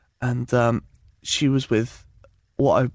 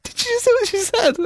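shocked high gasp of disbelief; high astonished squeak. Retold or imitated. Stunned friends reacting.

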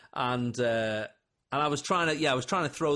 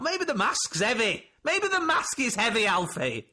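teasing; 10 semitones higher, 4 dB louder than usual. Retold or imitated. Teasing Alfie on the phone.

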